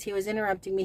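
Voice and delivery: ordinary delivery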